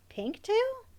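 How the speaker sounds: high, rising double take on TAIL; startled question. A narrator's reading. Emmy suddenly spots a rat's tail, startled question. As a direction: echo it in high disbelief, lifting on TAIL.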